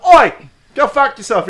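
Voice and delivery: hammed-up Australian-tinged street insult; British turned Australian. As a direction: shout it in a wobbly Australian-sounding British accent. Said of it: "That was Australian".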